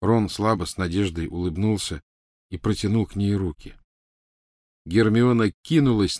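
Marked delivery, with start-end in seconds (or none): none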